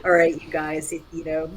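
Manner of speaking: normal talking voice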